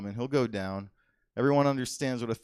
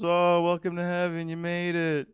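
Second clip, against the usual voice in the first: mock Saint Peter; 8 semitones higher, 6 dB louder than usual. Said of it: "Saint whatever is just".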